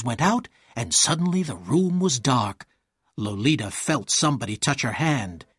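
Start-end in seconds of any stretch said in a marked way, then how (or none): none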